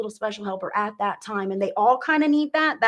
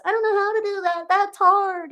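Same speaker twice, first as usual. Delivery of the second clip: put-on high little-kid voice, complaining; a small kid who doesn't want to try; whiny child; 9 semitones higher, 3 dB louder than usual. Child telling mom the chore is too hard, high and whiny.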